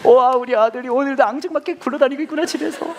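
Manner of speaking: high, loud, gushing over his son with a laugh; hammed-up adoring dad; doting father. Father gushing over his lazy son at home, mock adoring. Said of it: "막 그러면서 막 안아줬다는 거예요".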